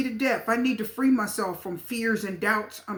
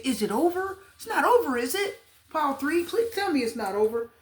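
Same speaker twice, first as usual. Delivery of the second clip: higher-pitched mock worried plea; anxious pleading; 5 semitones higher than usual. Voicing someone asking the reader if it's over, anxious pleading. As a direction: plead anxiously, as if needing reassurance. Voices querent's anxious question.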